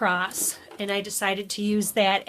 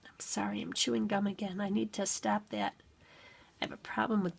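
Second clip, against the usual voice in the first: quiet aside; 6 dB quieter than usual. Real, not acted. Aside apology, whispered.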